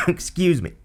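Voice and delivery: choking, squeezing out an apology. Real, not acted. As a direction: tight throat after coughing. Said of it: "And I'm choking now".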